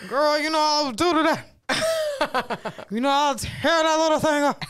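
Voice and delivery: put-on elderly man's voice; old man. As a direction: ham up a lecherous old man.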